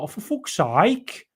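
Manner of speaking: high, slow Brummy moan; Brummy Grealish impression. Streamer imitating Grealish's Brummy accent, slow and high. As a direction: drag it out like Grealish in broad Brummy. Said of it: "um, Grealish, like".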